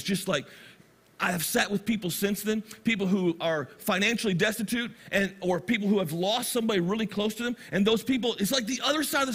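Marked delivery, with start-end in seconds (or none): none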